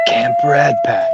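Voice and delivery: slow breathy ASMR murmur. Pretend. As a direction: hush it like an ASMR video. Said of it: "getting your A S M R in there".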